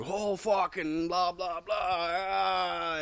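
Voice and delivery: high, very slow mock ranting; drawn-out mock frenzy; going mental. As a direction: ham up a man losing his mind. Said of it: "going all fucking mental".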